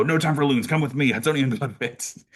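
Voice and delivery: high, hurried mock urgency; excited retold summons; retold urgent call. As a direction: act out brushing off a question mid-sprint. Retold rushed refusal while sprinting.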